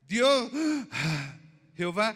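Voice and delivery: loud booming preacher's cry; mock preaching shout. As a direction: bellow "Dios" then "Jehová" from the pulpit. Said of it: "si yo voy a predicar acá y decir". (grito de predicador, fuerte y exagerado)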